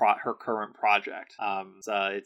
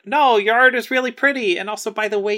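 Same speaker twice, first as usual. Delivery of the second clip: higher, lightly voiced flattery; flattering Felisa; 11 semitones higher, 5 dB louder, faster than usual. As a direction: coo the compliments in a mock-sweet voice. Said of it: "And Lisa's like".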